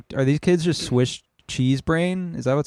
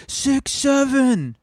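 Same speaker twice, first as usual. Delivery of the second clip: higher, drawn-out mock kid yell; kids yelling a meme; 12 semitones higher, much slower than usual. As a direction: call it out slowly like an excited kid in a crowd. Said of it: "two kids in the audience going".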